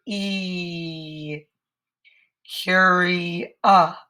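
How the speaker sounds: stretching each sound of "curious" slowly apart. Shown as a demonstration. Teacher sounding out curious for young writers. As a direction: sound out curious piece by piece, k, cu, cure. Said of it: "You can hear Taro being curious now".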